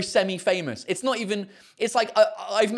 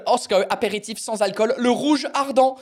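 reading the label in French; French label read aloud; French pronunciation; 5 semitones higher, 4 dB louder than usual. Real, not acted. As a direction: say the brand name the French way. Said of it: "It's called".

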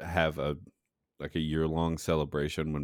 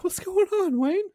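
mock kid voice; 6 dB louder than usual. Kid asks uncle in story.

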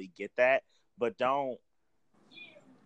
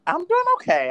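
high sassy greeting; 10 dB louder than usual. Real, not acted.

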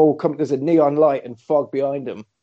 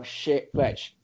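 ordinary talk

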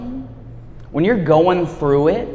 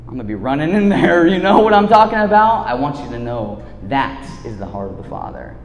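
ordinary talk, nothing marked

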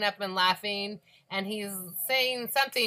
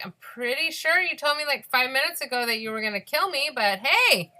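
sarcastic retort; 6 semitones higher, 6 dB louder than usual. Retold or imitated.

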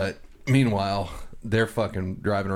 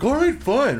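mock whiny drunk; 7 dB louder than usual. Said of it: "And he's like".

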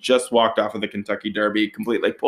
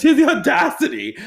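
outraged; 5 dB louder than usual. Real, not acted. Outraged retelling.

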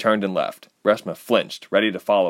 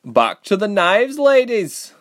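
loud call; 13 semitones higher, 6 dB louder, slower than usual. Acted. Sebra calls the girls' attention.

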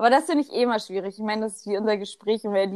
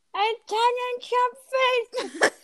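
very high, drawn-out put-on voice; film quote voice; 14 semitones higher, much slower than usual. Quoting a film line to a friend, high put-on voice. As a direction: stretch each syllable high in mock character.

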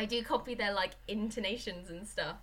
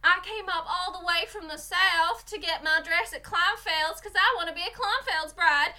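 loud, high Southern bride; hammed-up American Southern twang; Southern accent; 8 semitones higher, 8 dB louder than usual. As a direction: imitate a gushing Southern bride on TV. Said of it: "Southern people".

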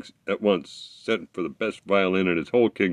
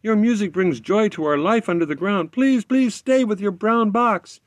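high, louder small-creature voice, begging; pleading little creatures; 12 semitones higher, 3 dB louder than usual. Acted. Underground creatures beg the fiddler to stay, high pleading voice. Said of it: "implored the creatures in a chorus".

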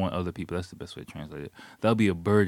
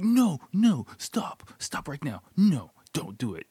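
mock alarmed yell, high at first then strained and hushed; retold yelling; 8 semitones higher, 5 dB louder, slower than usual. Staff yelling at climbing hiker, imitated yell. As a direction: imitate staff frantically calling someone back. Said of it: "the staff is yelling at the dude".

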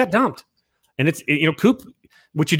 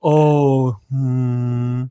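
mock moping. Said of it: "you don't wanna hear a guy going".

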